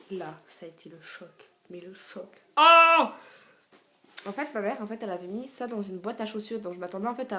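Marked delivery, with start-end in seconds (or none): 0.1-2.4 low, hushed dramatic build-up; quiet storyteller setting up a shock; hushed suspense. Retold or imitated. Builds suspense before retold shock. 2.6-3.1 loud, high-pitched "oh" of shock; hammed-up scream of surprise; shocked shriek. Acting out her shock.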